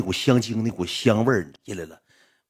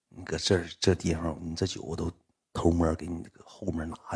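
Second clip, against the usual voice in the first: hushed secret; 5 semitones lower, 7 dB quieter than usual. Retold or imitated. Hush, sneaking cheaper liquor from the back.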